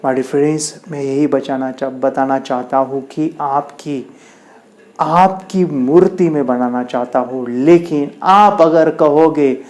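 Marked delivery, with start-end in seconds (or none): none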